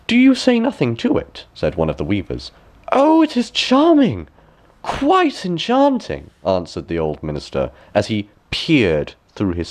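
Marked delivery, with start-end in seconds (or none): 0.1-1.5 sly weaver. A narrator's reading. Weaver voiced in story. 2.9-6.3 put-on high, slow, gushing old courtier; fake delight. A narrator's reading. Minister to the weavers, faking rapture in a high slow gush.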